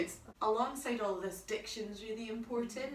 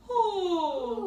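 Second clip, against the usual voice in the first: yawn sound; 8 semitones higher, 9 dB louder than usual. Shown as a demonstration. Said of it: "Yawn, kind of".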